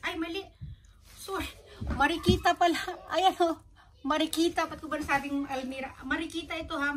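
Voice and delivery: high, loud flustered correction; oops, loudly fixing the winner's name; excited correction. Real, not acted.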